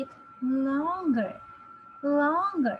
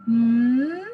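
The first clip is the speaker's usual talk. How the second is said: loud held mm; big mm; 10 dB louder than usual. Shown as a demonstration. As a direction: hum the mm big and strong.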